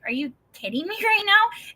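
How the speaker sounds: high-pitched laughing disbelief; astonished, voice jumping up; incredulous. Retold or imitated. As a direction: blurt it out in amused disbelief. Incredulous reply to God retold.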